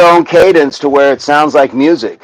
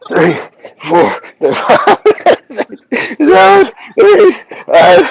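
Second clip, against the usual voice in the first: strained counting, voice climbing higher; straining. Real, not acted. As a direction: push out the last reps. Last reps of a hard exercise.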